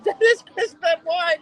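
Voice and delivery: high, loud, slow mock reply; somebody correcting him. Quoting someone correcting the boss, high and drawn out. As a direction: spell it out for a clueless boss. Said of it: "And somebody told him".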